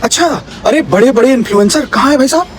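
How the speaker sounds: ordinary talk, nothing marked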